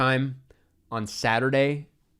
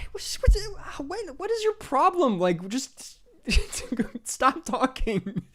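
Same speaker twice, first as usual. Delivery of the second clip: much higher, incredulous, slowing at the end; exasperated disbelief; 13 semitones higher, slower than usual. Retold or imitated. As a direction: cry out in baffled disbelief at the rude guy. Exasperated retort to loud stranger.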